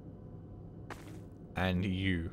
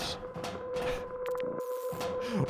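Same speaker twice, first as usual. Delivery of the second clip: high laugh; 7 dB quieter than usual. Real, not acted. Laughs after slipping into accent.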